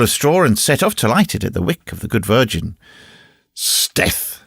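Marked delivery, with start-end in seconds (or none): 3.5-4.4 growled. A narrator's reading. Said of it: "growled Gringoire".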